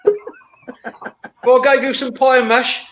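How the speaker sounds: lightly hammed-up Cockney; mock Cockney. Cockney accent, telling friends a pie and mash joke.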